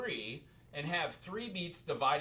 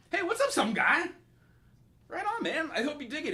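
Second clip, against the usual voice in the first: much higher, bright hello; excited greeting; 11 semitones higher, 5 dB louder than usual. Real, not acted. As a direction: greet a newcomer with a lift. Greeting a viewer who joined.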